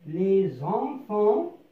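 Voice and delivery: "Les enfants" dragged out very slowly, rising on "enfants"; slow teaching read. Shown as a demonstration. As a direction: model "Les enfants" at a crawl. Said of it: "لنقرأها مع بعض".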